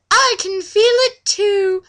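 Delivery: put-on high, slow child voice; little girl voice. Laura explaining why Legolas keeps her, high and slow. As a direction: say it sweetly way up high.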